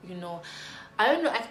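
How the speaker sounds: ordinary delivery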